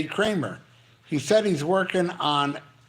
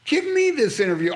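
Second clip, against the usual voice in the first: mock demand; 7 semitones higher, faster than usual. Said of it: "you know how I say".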